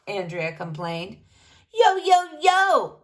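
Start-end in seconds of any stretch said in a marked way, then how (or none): 1.7-3.0 loud, slow, high "yo, yo, yo" call to the crowd; rapper's shout. Acted. Rapper greeting the school assembly with a loud shout. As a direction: belt it out like a rapper hyping the room. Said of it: "Mister Hind shouted".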